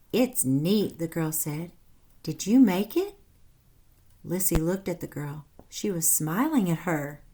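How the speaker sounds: lively read-aloud storytelling voice; animated storybook narration for children; story narration. A narrator's reading. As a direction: read it like a picture book to kids. Reads story aloud to class.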